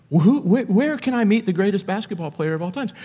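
silly fan asking Jordan where Jordan is, mock naive. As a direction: play the fool asking Michael Jordan himself. Silly version, asking Jordan himself.

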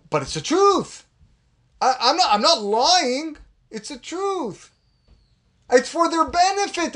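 a hint of a self-justifying gossip; mock gossiper. Gossiper defends his lashon hara, mock-whiny.

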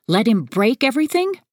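high, indignant question; demanding. A narrator's reading. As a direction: challenge with a rising, incredulous pitch. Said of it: "I demand".

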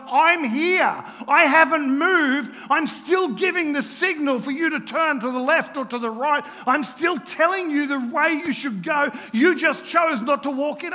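loud, high-pitched exasperated insistence; frustrated shout. Acted. Jesus answering those who blame him, frustrated. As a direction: cry out in frustration at not being followed. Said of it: "I wonder if Jesus ever gets frustrated".

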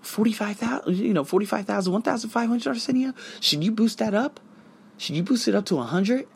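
light, high-pitched self-questioning; questioning inner voice. Acted. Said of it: "I'm reading through it, and I'm like".

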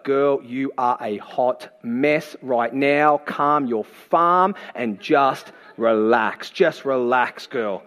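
slow, drawn-out soothing; calming Jesus. Acted. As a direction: talk her down very slowly, each word stretched. Voices Jesus calming Martha down.

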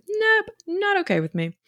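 high, emphatic nope; firm refusal. Shown as a demonstration. Coach demonstrating a boundary phrase to moms, firm and emphatic. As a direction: draw a firm line with it. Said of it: "a good phrase you can start to use".

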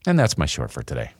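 usual speaking voice

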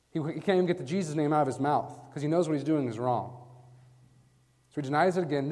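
ordinary delivery

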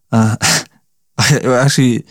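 breaking into a laugh mid-sentence; can't keep a straight face. Real, not acted. Vlogger retelling the frozen nose, real laughter. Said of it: "I know I'm laughing".

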